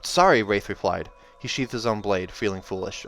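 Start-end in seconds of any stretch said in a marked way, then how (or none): 0.0-0.4 hasty apology. A narrator's reading. Wraith apologizes after fight.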